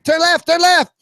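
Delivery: put-on high, loud accented instruction; urgent shouted command. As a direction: shout it as the flustered foreign-accented coach. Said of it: "And the guy goes".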